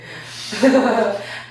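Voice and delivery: laugh. Real, not acted. Laughing at own bit.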